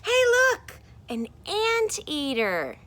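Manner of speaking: high, loud excited cry, then a slow point at the anteater; excited call. Acted. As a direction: shout it out as if spotting something amazing, then draw it out slowly. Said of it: "This one says".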